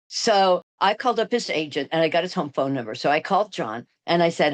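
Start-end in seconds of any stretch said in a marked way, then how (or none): none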